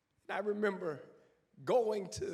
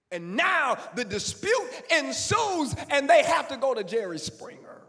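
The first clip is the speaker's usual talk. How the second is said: loud, high preaching climax; full-force preaching; 6 semitones higher, 11 dB louder than usual. Real, not acted. Pastor preaching to the congregation about mothers, full force. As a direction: preach it to the rafters.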